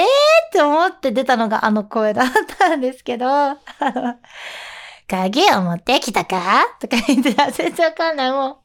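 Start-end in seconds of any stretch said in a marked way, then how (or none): 5.1-6.7 low, slow, husky growl of a dog character; raspy gruff voice. Shown as a demonstration. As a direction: croak it out like a rumbling motorbike engine. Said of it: "なんかしゃがれ声みたいな".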